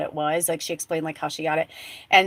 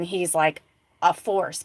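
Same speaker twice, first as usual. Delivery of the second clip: ordinary talk, nothing marked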